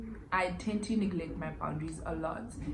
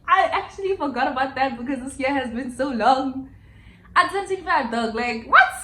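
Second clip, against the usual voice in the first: higher and louder, half-laughing in disbelief; shocked disbelief; 7 semitones higher, 10 dB louder than usual. Real, not acted. Telling viewers she forgot her birthday, shocked and loud. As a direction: react like you just found out.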